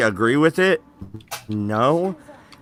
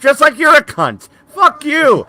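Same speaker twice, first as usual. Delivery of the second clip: hammed-up fury, higher and a bit louder; mock rage; 7 semitones higher, 4 dB louder than usual. Fake-angry gamer yelling at a friend who mocks him.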